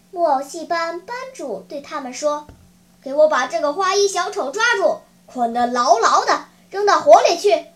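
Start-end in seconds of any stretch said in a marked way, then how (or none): 3.0-7.7 loud ogre ordering his guards; fierce, commanding puppet master; barked command. Acted. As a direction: give the order forcefully, building louder.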